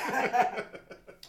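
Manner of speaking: a loud burst of real laughter. Real, not acted. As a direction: laugh out loud, then let it fall. Laughing at own awkward intro. (lautes, herzhaftes Lachen)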